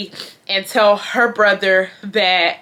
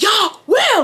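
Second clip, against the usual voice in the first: high-pitched mock scream; imitated screaming; 12 semitones higher, 3 dB louder than usual. Advisor breaking up two arguing students, mock scream. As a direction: imitate him screaming out over everyone. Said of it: "he started screaming".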